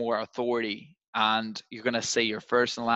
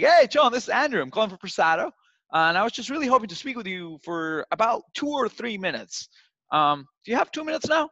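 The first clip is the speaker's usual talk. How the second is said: matched high energy; 9 semitones higher than usual. Shown as a demonstration. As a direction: pump your energy up to meet theirs. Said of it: "Just match that energy level".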